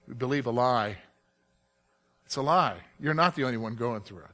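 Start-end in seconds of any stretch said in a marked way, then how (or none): none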